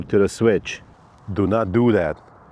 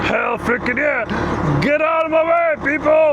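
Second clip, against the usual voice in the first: hyped shouting. Real, not acted. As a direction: yell it like a pumped fan. Hyped intro before install.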